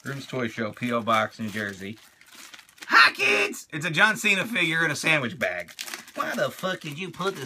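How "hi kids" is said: high toy voice. Acted. Toy figure greets kids.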